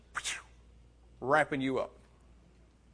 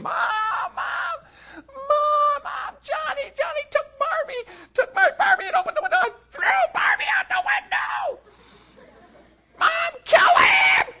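put-on very high, loud shrieking child voice; screaming little girl; 6 dB louder than usual. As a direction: shriek like a five-year-old tattling to mom. Child tattling, screaming for mom.

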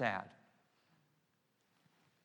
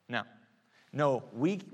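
usual speaking voice

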